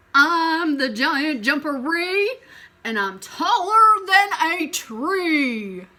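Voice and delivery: slow, loud, menacing boom; booming giant voice. Acted. Giant threatens Elephant.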